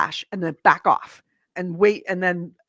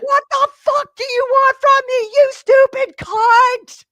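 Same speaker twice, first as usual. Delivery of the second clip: much higher, louder furious yell; yelling at the top of lungs; 9 dB louder than usual. Retold or imitated. Gamer reenacting screaming at the game, higher and louder. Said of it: "at the top of my lungs".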